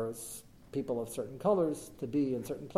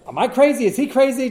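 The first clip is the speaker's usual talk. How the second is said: exasperated; 11 semitones higher, 11 dB louder than usual. Retold or imitated. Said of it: "He said".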